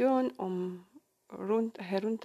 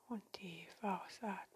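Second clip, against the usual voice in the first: soft spa whisper; 9 dB quieter than usual. Acted. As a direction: whisper them gently into calm. Leads a guided meditation.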